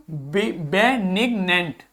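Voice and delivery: बेनिग्नेंट drawn out very slowly, be-nig-nent; slow pronunciation drill. Shown as a demonstration. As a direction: model बेनिग्नेंट slowly for students. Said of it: "अगला देखो". (बेनिग्नेंट बहुत धीरे, अक्षर-अक्षर)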